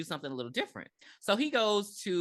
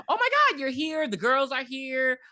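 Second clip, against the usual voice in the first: excited mom; 7 semitones higher, 3 dB louder than usual. Retold or imitated. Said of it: "and Jill's like".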